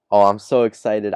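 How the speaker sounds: ordinary talk, nothing marked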